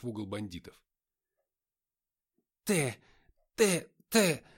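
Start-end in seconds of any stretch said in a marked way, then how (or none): 2.6-4.4 high, trembling stutter, shouted; stammering in terror. Acted. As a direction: choke out the letter in panic. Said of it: "Женщина стала заикаться".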